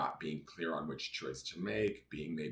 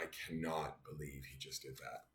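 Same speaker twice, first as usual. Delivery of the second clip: softer, contained exasperation; subdued disbelief; 2 semitones lower, 7 dB quieter than usual. Real, not acted. Owner stares at ruined bed, subdued angry disbelief. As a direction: say it under your breath, still angry. Fuming over dog chewing bed.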